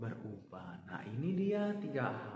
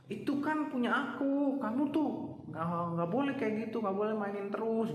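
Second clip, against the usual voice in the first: put-on high, louder childish scolding; bossy child; 9 semitones higher, 3 dB louder than usual. A child scolding a sibling over a toy, high and bossy. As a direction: scold a little brother like a kid.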